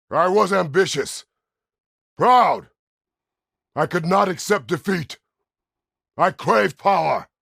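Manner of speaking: loud, high shouted outburst; roaring confession in short bursts; furious self-accusation. Acted. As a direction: hurl each admission out at full force. Shouted self-accusation of past faults.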